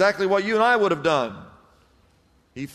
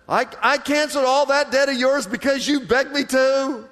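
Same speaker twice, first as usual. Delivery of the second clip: higher, lightly voiced stern master; angry master; 6 semitones higher than usual. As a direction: scold him as the wronged master. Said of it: "And in anger".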